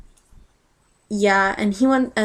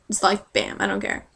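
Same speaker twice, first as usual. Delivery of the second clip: ordinary delivery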